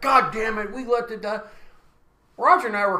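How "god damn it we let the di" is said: pissed off. Retold or imitated. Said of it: "I'd have been pissed".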